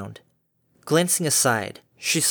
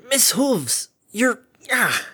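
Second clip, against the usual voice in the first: pained cry; 10 semitones higher, much slower than usual. Acted. Harmony punched repeatedly.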